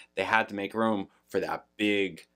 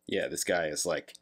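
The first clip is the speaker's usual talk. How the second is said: normal talking voice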